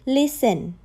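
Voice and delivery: ordinary delivery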